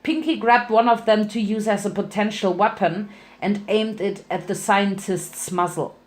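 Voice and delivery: plain reading voice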